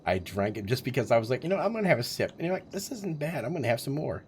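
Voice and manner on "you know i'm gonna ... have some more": high, light put-on voice for his past self; sing-song higher voice of a guy getting tipsy; mock inner voice. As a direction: play the careless drinker talking himself into another sip. Voicing his own tipsy thoughts.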